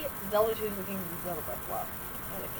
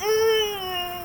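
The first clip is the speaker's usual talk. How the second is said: long, high, loud straining "uh"; straining grunt; 12 semitones higher, 5 dB louder than usual. Retold or imitated. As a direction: grunt as if pushing hard, drawn out. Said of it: "like you're pushing one out".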